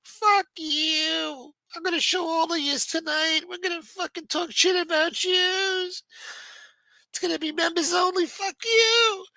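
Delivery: high mocking voice lampooning a foul-mouthed celebrity; mocking squeaky voice. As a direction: squeak out the threats like a cartoon brat. Mocking Rourke after his outburst.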